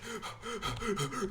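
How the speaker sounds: high startled gasp; sharp gasp. Retold or imitated. Sharp gasp on hearing the mean one call. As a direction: gasp in horror. Panicked reaction to mean instructor.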